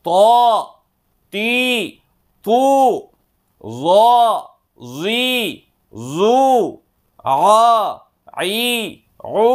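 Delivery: short syllables one by one, each rising at the end; recitation drill. Shown as a demonstration. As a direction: read each out for the class to echo. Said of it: "మీరు కూడా చదువుతుండండి నాతో పాటు". (ఒక్కొక్క అక్షరం పైకి ఎత్తి చదవడం)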